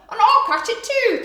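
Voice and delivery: high, loud excited yap; a small dog eager to join in; excited little dog. Acted. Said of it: "thought a little dog".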